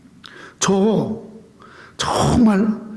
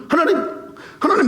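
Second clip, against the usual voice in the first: mock urgent calling; 8 semitones higher, faster than usual. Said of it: "막 이러면서".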